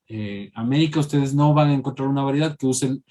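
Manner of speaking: ordinary talk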